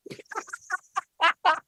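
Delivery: high delighted laugh; real delighted laugh; high burst of laughter. Real, not acted. Laughing at Conor's answer.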